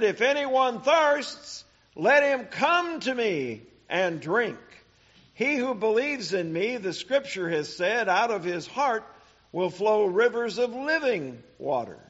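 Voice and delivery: proclaiming. Acted. Preacher proclaims Jesus' words.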